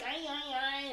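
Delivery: squeaky, whining robot imitation; high-pitched whiny voice. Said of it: "a very high-pitched, whiny voice".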